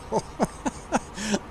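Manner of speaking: chuckle. Real, not acted. Laughing at the joke.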